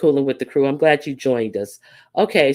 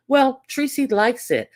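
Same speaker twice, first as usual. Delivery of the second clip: mock dismissive commenter; 7 semitones higher than usual.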